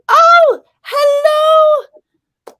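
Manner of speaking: very high, loud, sung-out hello; excited greeting. Real, not acted. Streamer greeting someone new in the chat, excited squeal. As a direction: squeal a big welcome.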